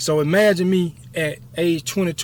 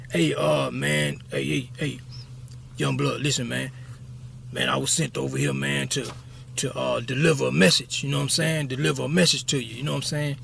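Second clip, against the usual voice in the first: quiet raspy voice, softer; 3 semitones lower, 5 dB quieter than usual. Retold or imitated. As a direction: keep it low and raspy, confiding. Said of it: "Troy had a real raspy voice".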